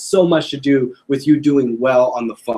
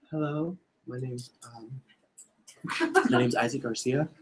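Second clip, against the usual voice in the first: much softer, hesitant phone greeting; timid; 2 semitones lower, 12 dB quieter than usual. Shown as a demonstration. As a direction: mumble it like you're scared to call. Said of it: "Or do you sound".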